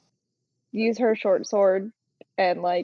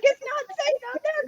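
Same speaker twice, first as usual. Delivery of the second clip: worried character voice; faster than usual. Acted. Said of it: "Belle would run".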